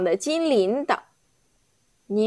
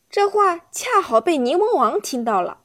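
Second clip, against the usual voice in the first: ordinary delivery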